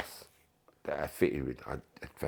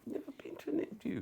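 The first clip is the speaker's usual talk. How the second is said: panicked whisper; 10 dB quieter, faster than usual. Retold or imitated. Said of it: "I'm like".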